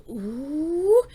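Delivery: rising, swelling "ooh"; imitated building instrumentation. Reactor describing the stripped-out instrumentation, imitating its build. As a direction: slide upward and swell like music building. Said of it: "The instrumentation was like".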